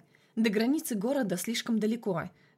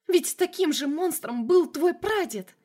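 much higher, louder, slow hysterical yell; hysterical shout; 8 semitones higher, 6 dB louder, slower than usual. A narrator's reading. Frightened Masha accuses Vlad, hysterical shout. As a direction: scream the accusation.

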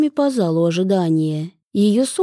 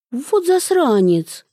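slow, higher mock outrage; good-natured indignation; 7 semitones higher, slower than usual. A narrator's reading. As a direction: drag it out in teasing protest. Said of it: "беззлобно возмутилась Дуся". (беззлобно возмущаясь)

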